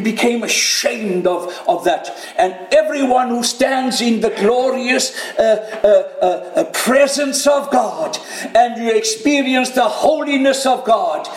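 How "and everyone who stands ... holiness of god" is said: high, forceful pulpit delivery; impassioned preaching. Real, not acted. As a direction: drive it home to the congregation, building in pitch.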